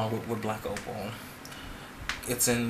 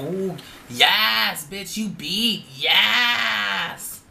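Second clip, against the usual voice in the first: imitating the girls; 6 semitones higher, much slower than usual. Mocking drag queens who cheer each other, high squeal. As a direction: ham up a screeching girl cheering. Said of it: "Be like".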